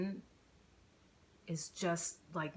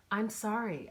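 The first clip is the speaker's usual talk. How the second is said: usual speaking voice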